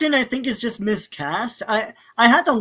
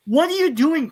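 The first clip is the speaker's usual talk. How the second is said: mock yell at screen; 6 semitones higher, 5 dB louder than usual. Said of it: "yelling at the screen".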